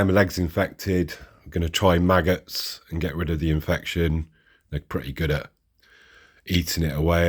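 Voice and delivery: ordinary talk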